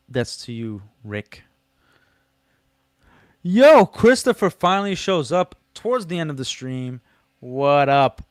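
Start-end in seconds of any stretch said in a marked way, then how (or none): 3.4-8.3 loud, high call out to a late arrival; yo, belt out the hello; loud greeting. Real, not acted. Streamer calls out to a viewer who finally arrived, loud and high.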